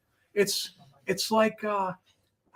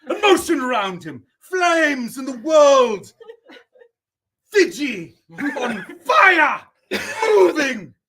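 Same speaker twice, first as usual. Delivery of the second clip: high, loud and slow, half laughing; crying out the flames like a tragic poet; mock dramatic critic; 7 semitones higher, 6 dB louder than usual. Critiquing a fan drawing on stream, loud mock tragedy.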